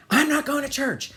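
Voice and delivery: put-on high, loud little-kid refusal; mock defiant child. Child refusing mother through bathroom door, mock defiant. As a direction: play a kid digging in his heels.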